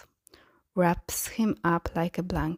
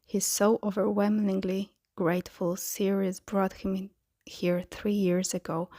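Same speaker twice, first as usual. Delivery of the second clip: ordinary delivery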